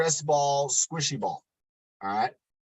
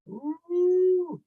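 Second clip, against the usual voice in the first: strained high ooh; 14 semitones higher than usual. Real, not acted. Straining during arm exercise.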